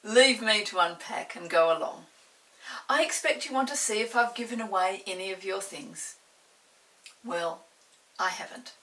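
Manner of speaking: loud, indulgent mother; mother letting the children go; amused mother. Acted. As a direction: grant permission with a chuckle. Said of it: "Mother laughed".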